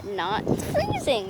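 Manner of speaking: high, drawn-out sarcastic denial; ironic denial. Real, not acted. Shivering outside, telling viewers she's fine, sarcastic. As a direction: say it like you're obviously freezing.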